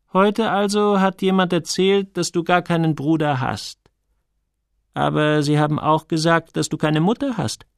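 a hint of gentle interrogation; lightly voiced Sonja, probing. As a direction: lightly play a curious woman confronting the boy.

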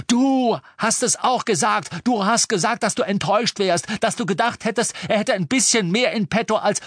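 high, loud accusing shout; shouted accusation. A narrator's reading. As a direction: keep yelling the charge at her.

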